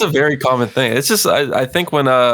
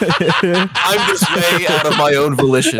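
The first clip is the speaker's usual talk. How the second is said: laughing; 4 semitones higher, slower than usual. Real, not acted. Defending himself amid laughter.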